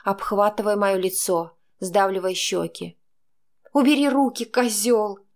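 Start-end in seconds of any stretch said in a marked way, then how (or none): 3.7-5.3 higher, louder hoarse rasp. A narrator's reading. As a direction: rasp it out in pain. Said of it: "прохрипела я".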